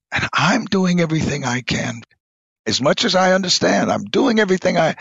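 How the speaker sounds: anguished plea pushed high; pleading prayer. Retold or imitated. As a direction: cry out to God, near breaking. Desperate prayer alone in kitchen.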